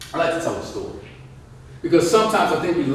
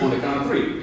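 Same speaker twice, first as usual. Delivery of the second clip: ordinary talk, nothing marked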